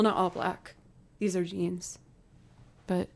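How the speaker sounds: ordinary delivery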